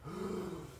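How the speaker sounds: a quick high gasp. Retold or imitated. Retelling the involuntary breath at the mirror, quick gasp. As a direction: catch your breath in sharply. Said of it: "took a deep ass breath".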